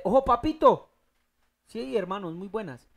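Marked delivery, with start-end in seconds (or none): 0.0-0.9 put-on loud, higher-pitched warning to a child; parental warning. Preacher voicing a parent cautioning their son, loud warning. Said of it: "usted dígale".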